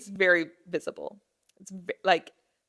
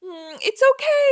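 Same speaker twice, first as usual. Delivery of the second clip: high, wobbly voice of a mom holding back tears; imitating mom forcing comfort; mom about to cry; 7 dB louder than usual. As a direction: soothe while close to tears. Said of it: "she's turning the picture like".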